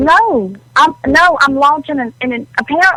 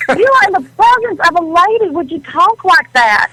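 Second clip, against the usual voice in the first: high-pitched, affronted Southern-lady scolding; indignant outrage; 7 semitones higher than usual. Acted. Offended lady scolding the host for crude talk, indignant.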